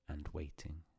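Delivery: ordinary delivery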